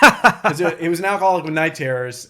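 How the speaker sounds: loud laughing delivery; loud laughing remark. Real, not acted. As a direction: laugh while saying it. Laughing through the line.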